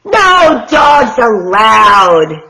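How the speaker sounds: loud and slow, cross; slow angry yell. Acted. Hank yells at the coyote, loud and slow. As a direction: bellow each word slowly. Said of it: "Hank yelled".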